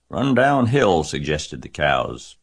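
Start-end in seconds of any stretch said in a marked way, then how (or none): 0.1-1.0 raised, higher calling voice; called advice. A narrator's reading. Cows advise fleeing Wilbur, calling out. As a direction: call it out to the runaway.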